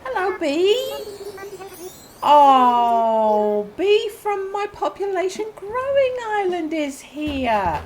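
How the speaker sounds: high, slow, fond greeting; drawn-out adoring coo; cooing delight. Real, not acted. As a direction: gush slowly in a high voice. Greeting beloved character Bee.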